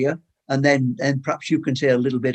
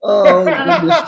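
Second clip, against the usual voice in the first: laughing; 8 semitones higher, 12 dB louder, much slower than usual. Real, not acted. Host reacts to power cut.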